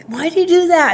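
annoyed. Retold or imitated. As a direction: nag him in mild mock irritation. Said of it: "like, super annoyed".

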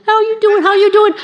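very high, squeaky dog voice; talking dog. Acted. Pet dog greeting its owner, silly and squeaky. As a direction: put on a silly high-pitched pup voice. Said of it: "my dog started".

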